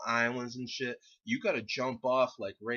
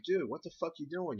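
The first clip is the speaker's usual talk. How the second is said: higher-pitched, puzzled questioning; puzzled demand; 7 semitones higher than usual. Retold or imitated. Asking the friend squatting behind a boulder, puzzled. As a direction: act out checking on a drunk friend.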